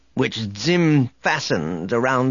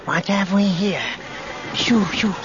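high, eerie soft voice; soft echoing dream voice; 8 semitones higher than usual. A narrator's reading. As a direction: coax softly, in a strange high voice. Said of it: "a soft voice whispered".

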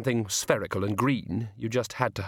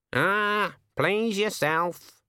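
much higher, slow teasing raven; 9 semitones higher, much slower than usual. Acted. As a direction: needle him like a cheeky bird. The raven shrugs it off.

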